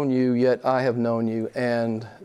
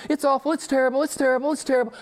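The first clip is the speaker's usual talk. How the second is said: mock despair; 11 semitones higher, faster than usual. Mimicking unbeliever obsessing over trials.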